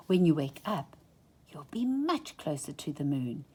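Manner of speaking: usual speaking voice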